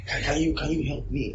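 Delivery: high, put-on beggar's plea; halting, high-pitched request; mock pleading. Acts out someone asking for help.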